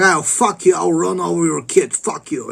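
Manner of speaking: rich boss sneer. Retold or imitated. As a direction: sneer back like a smug rich guy in a Mercedes. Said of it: "He's like".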